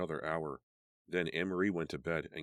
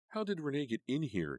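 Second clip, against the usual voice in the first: high, insistent demand; pitched-up demanding question; 10 semitones higher than usual. A narrator's reading. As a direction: press her for an answer. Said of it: "Karen demanded".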